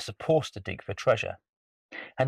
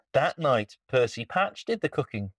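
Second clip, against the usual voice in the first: ordinary delivery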